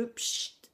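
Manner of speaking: breathy imitated spray hiss; spray sound. Shown as a demonstration. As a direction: mimic the pchit of a spray bottle.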